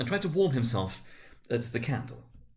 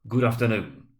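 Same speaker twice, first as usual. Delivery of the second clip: curt dismissal; 7 dB louder than usual. A narrator's reading. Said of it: "said Scrooge".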